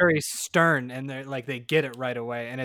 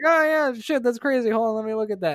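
much higher, louder mock salesman brush-off; higher, louder breezy mock excuse; imitating Wayne; 7 semitones higher, 4 dB louder than usual. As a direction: play the sales guy casually stalling. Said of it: "He'd be like".

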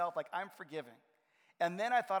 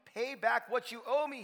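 higher, slowed demand for payment; 7 semitones higher, 4 dB louder, slower than usual. A narrator's reading. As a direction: press the debtor hard. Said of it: "he demanded".

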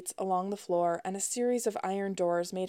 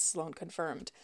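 ordinary delivery, nothing marked

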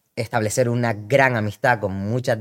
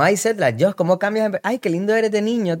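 higher, mock-doting voice; gushing fans; 9 semitones higher than usual. Fans doting on the small guy, higher cooing voice. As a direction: coo over him like an auntie. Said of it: "luego es como".

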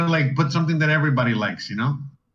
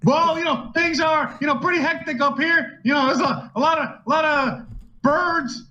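high, frantic traffic reporter voice; silly clown; 13 semitones higher than usual. Pretend. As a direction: squeal like a panicked reporter in a chopper.

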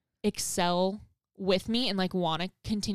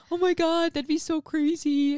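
higher, louder excited friend; excited gasp; 8 semitones higher, 4 dB louder than usual. Retold or imitated. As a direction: squeal it like amazing news. Friend's excited reply, retold.

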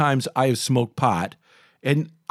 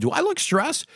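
much higher, incredulous question; a sudden high pitched "do I?"; surprised retort; 13 semitones higher than usual. Retold or imitated. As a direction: act startled at being called stressed. Retorting to nanny's remark.

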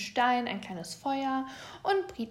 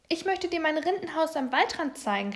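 higher, eager storybook call; 6 semitones higher, 4 dB louder than usual. A narrator's reading. Little bear to busy Frau Eichhorn, calling out. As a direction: call after someone who is busy. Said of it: "rief der kleine Bär".

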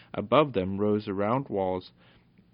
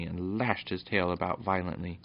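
ordinary delivery

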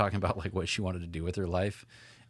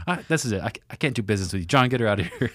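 louder, fast mock tirade; imitating his friend yelling, louder and fast; 7 dB louder, faster than usual. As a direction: ham up the friend's outburst. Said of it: "He's like, he's like".

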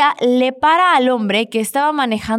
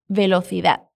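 Spanish accent; 5 semitones lower than usual. Shown as a demonstration. Teacher gives learners one word to copy, Spanish accent. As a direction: lower Castilian accent, th in "Velocidad". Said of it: "también con el acento español".

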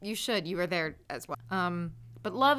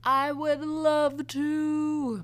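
drawn out; 7 semitones higher, 4 dB louder, much slower than usual. Pretend. Playful drawn-out reply.

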